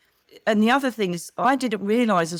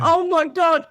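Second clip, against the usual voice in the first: high, loud, thrilled exclamation; excited gasp; 9 semitones higher, 6 dB louder, slower than usual. Retold or imitated. Retelling her first sight of the Hogwarts pictures, thrilled. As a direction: gush it like a starstruck fan.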